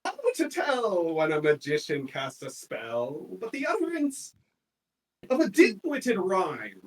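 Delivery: a comic performer reciting to a tavern crowd, hammed up; comedian's rhyme. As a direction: ham up the jester's rhyme for the crowd. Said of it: "and he says".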